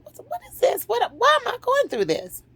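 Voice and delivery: high-pitched exasperated wail; distressed questioning. Retold or imitated. Woman questioning her unhealed past, distressed high wail. Retold distress at still not being over it.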